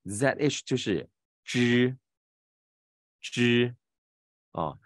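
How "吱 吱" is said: 吱 held long with the tongue tip curled up; drawn-out, clearly articulated retroflex 吱; retroflex zh demo. Shown as a demonstration. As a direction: model 吱 slowly in a high, level tone. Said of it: "你注意听声音怎么念啊".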